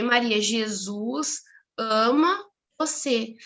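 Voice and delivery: word-by-word demonstration. Retold or imitated. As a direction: show each word separately to a child. Mother signs the phrase word by word.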